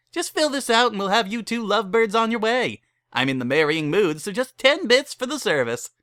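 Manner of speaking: high, loud, eager wedding-chapel host; cheery chapel keeper. Acted. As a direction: sell them a wedding with pushy cheer. Barry pushes the scroll.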